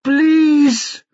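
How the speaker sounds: very high, drawn-out plea; desperate scream. A narrator's reading. Brother begs for his sister's life, screaming. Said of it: "screamed Tyrone".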